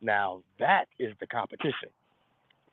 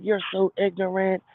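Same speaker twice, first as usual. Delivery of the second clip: high, scornful voice of offended women; scolding women; 6 semitones higher than usual. Retold or imitated. Women on the panel scolding a careless dude, high and scornful. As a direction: scold him like the whole room is on your side. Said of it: "the women gonna be like".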